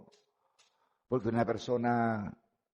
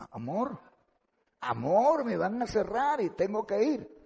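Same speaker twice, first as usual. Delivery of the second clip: higher, rushed pleading to his wife; a husband pressing his wife on the phone, urgently pleading; 9 semitones higher than usual. Retold or imitated. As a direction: beg her to hurry, voice climbing high.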